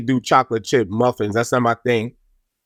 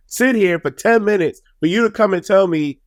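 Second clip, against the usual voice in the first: ordinary talk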